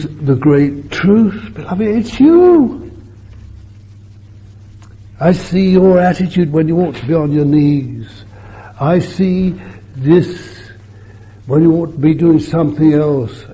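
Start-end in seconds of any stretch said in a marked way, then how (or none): 1.8-2.9 sudden high, loud outburst; ringing exclamation. Real, not acted. Preacher crying out to his beloved congregation, high and loud. 5.2-13.4 fervent preaching. Real, not acted. Preaching at full force.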